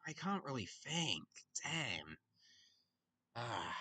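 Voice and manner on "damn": ordinary talk, nothing marked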